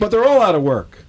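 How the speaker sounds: loud, high comic exasperation; exasperated protest. Acted. Acted exasperated protest in routine.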